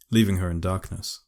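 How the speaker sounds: plain reading voice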